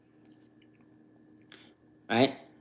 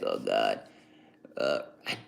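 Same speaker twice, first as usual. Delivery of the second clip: very low, hushed mock groan; acted weary dismay; 10 dB quieter than usual. Speaker dreading someone's company, low mock dismay. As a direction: groan it under your breath, far down in pitch.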